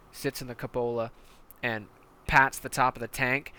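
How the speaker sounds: ordinary talk, nothing marked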